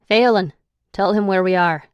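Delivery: loud Irish command; order. A narrator's reading. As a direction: snap the order at Phelan. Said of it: "Declan orders".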